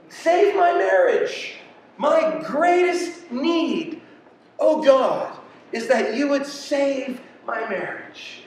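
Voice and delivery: high, drawn-out plea to God; pleading prayer. Acted. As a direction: plead slowly from a high pitch. Acted plea to Jesus.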